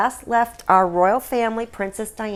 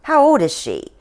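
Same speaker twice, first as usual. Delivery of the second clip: exasperated queen; 6 semitones higher, 4 dB louder than usual. Acted.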